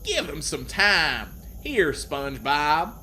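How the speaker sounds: loud, slow cartoon character voice; Sandy. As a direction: put on a big voice and stretch the words. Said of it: "Sandy said".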